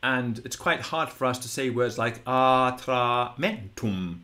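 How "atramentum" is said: atramentum slow, long unaccented a's, punched short MEN; accent on short vowel. Shown as a demonstration. Latin teacher showing a hard word, accent on short vowel. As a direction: draw out "aah-traah", then hit MEN in atramentum. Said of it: "accent is on a short vowel".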